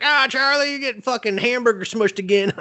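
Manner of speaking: high, teasing jeer at Charlie; sing-song ribbing from the whole gang; mocking crowd. Friends teasing Charlie in a high mocking jeer.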